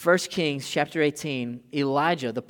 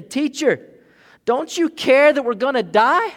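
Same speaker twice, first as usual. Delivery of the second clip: desperate cry; 11 semitones higher, 11 dB louder than usual. Retold or imitated. Disciples cry out in storm.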